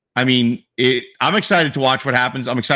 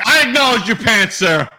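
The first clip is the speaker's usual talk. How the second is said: laughing mock formal; 8 semitones higher than usual. Retold reply, laughing.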